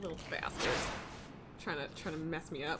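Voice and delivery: muttered grumble at the game. Real, not acted. Streamer grumbling at rival racers under her breath.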